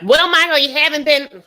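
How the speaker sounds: high, loud complaining voice; Angela. Acted. Angela on the phone to Michael, complaining. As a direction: raise pitch and volume in an acted nag.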